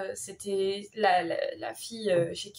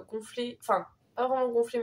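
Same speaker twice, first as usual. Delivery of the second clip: ordinary delivery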